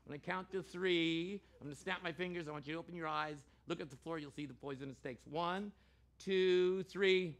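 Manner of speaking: ordinary delivery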